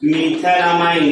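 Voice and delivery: slow dictation. Shown as a demonstration. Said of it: "नाम आएगा".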